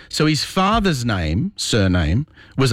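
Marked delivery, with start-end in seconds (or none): none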